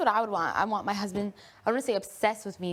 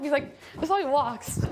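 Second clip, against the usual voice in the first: ordinary talk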